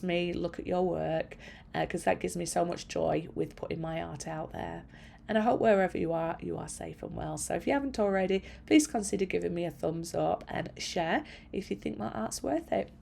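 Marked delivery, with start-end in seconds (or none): none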